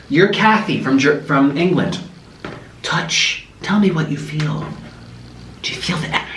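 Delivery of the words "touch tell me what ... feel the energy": hushed, near-whispered mystic prompting; crazy German lady. Retold or imitated. Crazy German lady guiding a guest's hand on rocks, hushed mock-mystical. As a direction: imitate a healer coaxing in a whisper. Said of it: "the crazy German lady".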